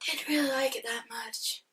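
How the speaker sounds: whispered, as if owning up to something; whispered confession. Real, not acted. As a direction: breathe it out like a secret. Confessing dislike.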